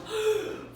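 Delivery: sharp high gasp of alarm; mock shocked gasp. Maitre d' hearing the puppy is for the child, shocked gasp. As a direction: gasp as if scandalized.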